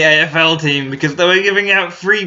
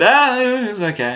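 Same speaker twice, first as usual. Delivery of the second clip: higher, unimpressed shrug of a voice; imitating dad; 10 semitones higher than usual. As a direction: mimic dad brushing off the film.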